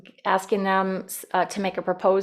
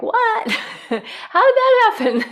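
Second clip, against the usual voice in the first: much higher, laughing what, how did that happen; amazed disbelief; 6 dB louder, slower than usual. Retold or imitated. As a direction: marvel that it worked, laughing. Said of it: "you're like".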